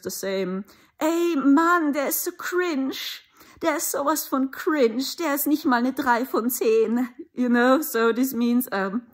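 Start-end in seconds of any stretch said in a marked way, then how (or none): 1.0-7.1 higher, mocking German youth voice; TikToker teen. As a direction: ham up a snobby TikToker judging someone. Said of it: "when you watch some TikTokers".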